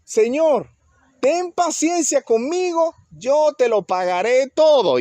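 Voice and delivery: high, slow begging plea; kneeling servant begging his master, high and slow; pleading servant. Acted. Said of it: "se postró y le dijo".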